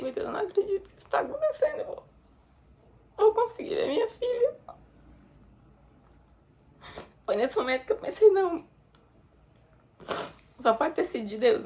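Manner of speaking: high, quick tearful voice; sobbing out the relief; crying. Real, not acted. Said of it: "Desculpa, gente". (chorando de alívio)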